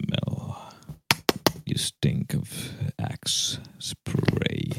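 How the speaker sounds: very slow, soft whisper; mock-sensual creeping whisper; ASMR whisper. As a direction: stretch each word in a whisper. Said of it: "you need to whisper".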